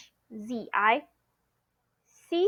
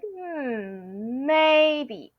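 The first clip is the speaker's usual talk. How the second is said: tricky; 2 semitones higher than usual. Shown as a demonstration. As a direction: stall with a hmm, then tease out 'maybe'. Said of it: "It's the tricky kind".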